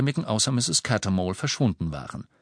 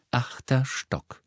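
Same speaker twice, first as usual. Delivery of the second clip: slow, cool announcing witch; 4 semitones higher, much slower than usual. A narrator's reading. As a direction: announce the floor coolly, slowly. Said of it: "sagte die kühle Stimme der Hexe".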